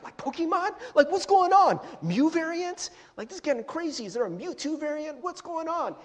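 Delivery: high-pitched, incredulous questioning; mock bewilderment. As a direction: react in exaggerated disbelief. Mock bewilderment at variant news.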